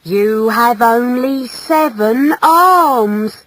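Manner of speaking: slow, mournful wail; grieving mother breaking bad news; sadly. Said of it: "said his mother sadly".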